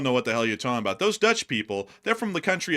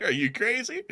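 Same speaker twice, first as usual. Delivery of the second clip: mock incredulous; 10 semitones higher than usual. Imitating aggressive correctors.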